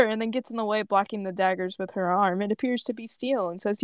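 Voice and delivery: ordinary talk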